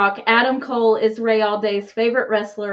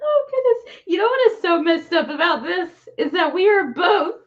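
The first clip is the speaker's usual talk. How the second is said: high, giggly words still laughing; words bubbling out mid-laugh; 6 semitones higher than usual. Real, not acted. Co-host recovering from a laughing fit, still giggling.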